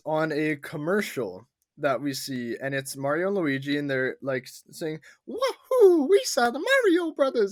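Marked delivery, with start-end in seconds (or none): 5.3-7.5 high cartoon Italian accent, bursting with laughter; hammed-up Italian accent, squeaky and loud; wacky Italian accent. Co-host reenacting the movie's commercial, wacky Italian accent. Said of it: "with wacky Italian accent".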